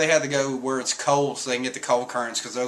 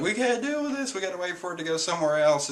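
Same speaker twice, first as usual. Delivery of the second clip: high, fast nervous plea; scared Caribou; 6 semitones higher, faster than usual. Retold or imitated. Caribou scared of the Kraken, high hurried plea. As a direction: beg them to wait it out. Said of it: "So Caribou's like".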